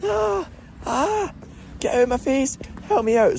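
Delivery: very high, strained mock shout; struggling mother shouting. Struggling Glasgow mother to her child, mock shouting. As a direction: screech it without much volume, like a harried mother. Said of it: "it's just shouting all the time".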